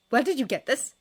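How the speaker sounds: sharp, insistent question; demanding. A narrator's reading. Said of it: "she demanded".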